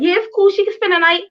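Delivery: lightly mock the other mom's easygoing yes; other mom on phone. Mother retelling the phone call, mimicking the other mom's breezy voice. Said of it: "So her mom's like".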